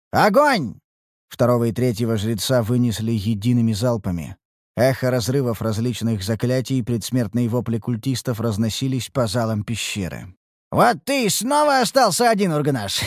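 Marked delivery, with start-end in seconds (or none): 0.1-0.7 loud sharp command to fire; shouted command. Acted. 10.7-13.0 triumphant. Acted. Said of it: "торжествующе произнёс я".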